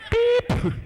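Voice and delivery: very high loud beep; machine beep. Retold or imitated. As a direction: chirp like an answering machine. Answering machine beep sound.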